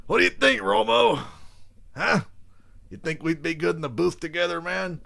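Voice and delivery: high, loud coach impression; punchy, hammed-up football coach voice; John Gruden. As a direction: call out eagerly to a booth partner. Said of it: "That kinda bled into Romo there".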